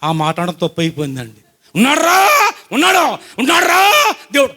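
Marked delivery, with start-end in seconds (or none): 1.8-4.5 high, loud furious shouting; scolding neighbour shouting furiously; angry yell. Retold or imitated. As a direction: yell it back at him in a rage. Angry woman yells back at him.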